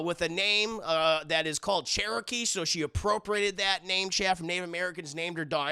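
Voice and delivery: ordinary delivery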